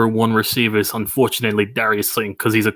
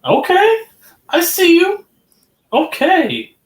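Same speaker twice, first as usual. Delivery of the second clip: high mock voice; 6 dB louder, slower than usual.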